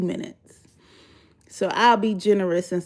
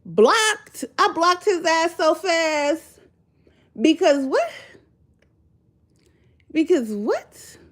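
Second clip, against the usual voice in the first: higher, emphatic rhetorical questions; higher, incredulous and insistent; what was he thinking; indignant; 8 semitones higher, slower than usual. Real, not acted. Indignant punchline.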